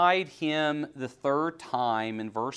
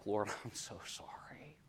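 whispered prayer; 3 semitones lower, 10 dB quieter than usual. Pretend. Sinner pretending to apologize to the Lord, whispering. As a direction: pretend repentant whisper, soft. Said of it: "it's like".

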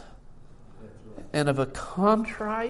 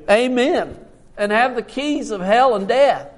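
loud, raised proclamation with weighty pauses; preached scripture reading; 4 semitones higher, 8 dB louder than usual. Real, not acted. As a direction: build from a firm start into a loud, triumphant declaration.

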